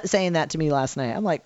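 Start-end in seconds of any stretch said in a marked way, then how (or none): none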